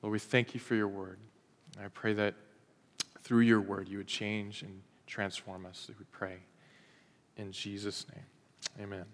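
soft, reverent prayer voice; soft closing prayer before the sermon; hushed prayer. Acted. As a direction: pray quietly and reverently to close. Opening prayer, quiet and hushed.

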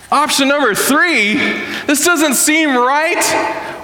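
high-pitched incredulous echo, stretching into protest; mock disbelief. Believer questioning God's unexpected third option, incredulous. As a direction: play someone balking, voice way up. Acts disbelieving reply to God.

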